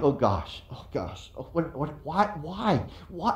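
low, breathy panicked mutter; gasping oh gosh as if terrified; mock panic. Watching the climb, he mock-panics under his breath.

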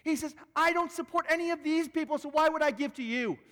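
high, cutting indignation; angry lawyer. Retold or imitated. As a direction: cut her off, scornful. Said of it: "the man cuts her off and says".

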